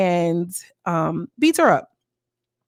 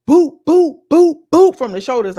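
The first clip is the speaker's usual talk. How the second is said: mock punch sounds; 6 semitones higher, 9 dB louder than usual. Acting out hits from behind.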